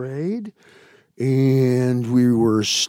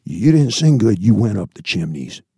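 forceful retold warning, dropping low; very serious; 3 semitones lower, 6 dB louder than usual. Survivor answering a pupil about singing, very serious. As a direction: lean in and press each word home. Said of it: "he was very serious. He would lean forward".

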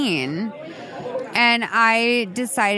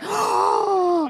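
sharp gasp; 7 semitones higher than usual. Real, not acted. Sudden idea.

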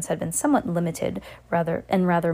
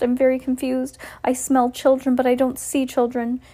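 higher fretful witch voice; fussing witch; 7 semitones higher, 4 dB louder than usual. A narrator's reading. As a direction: fret anxiously. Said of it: "she fussed".

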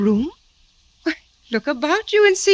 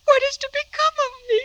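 much higher, fast panicked cry; panicked wail; faster than usual. Acted. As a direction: despair spilling out. Panic after lover's death.

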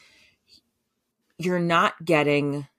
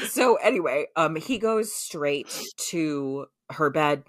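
ordinary delivery

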